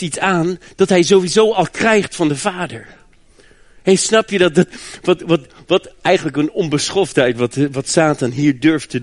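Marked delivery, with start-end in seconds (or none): none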